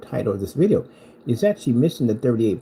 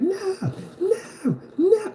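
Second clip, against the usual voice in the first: very high mock wails of no; mock screaming; much slower than usual. Rival at the TV in a mock crying scream. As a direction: wail it high like a meltdown. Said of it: "screaming like".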